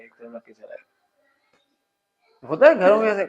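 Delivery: normal talking voice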